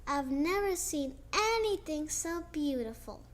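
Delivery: plain reading voice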